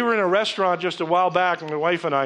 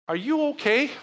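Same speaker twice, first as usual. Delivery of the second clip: high, lifted concern on "you"; concerned question; 9 semitones higher than usual. Retold or imitated. Pastor checking on a sad waitress, lifted and caring.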